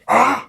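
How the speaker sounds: sudden high yelp of exasperation; a short, loud, high "Ah!" of disbelief; outraged yelp; sharp cry. Real, not acted. Sudden outburst at the stream.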